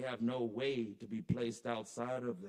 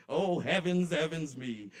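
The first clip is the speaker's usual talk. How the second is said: laughing exclamation; 5 semitones higher, 7 dB louder than usual. Acted. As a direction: laugh breaking into a loud, high "oh, heavens". Repeated exclamation, now laughing.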